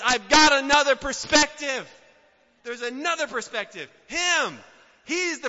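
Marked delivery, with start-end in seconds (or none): 0.0-1.9 loud, bold declaration; God's confident voice. Acted. Preacher voicing God to tempted listeners, loud and bold. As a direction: proclaim it as God himself. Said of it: "But God says".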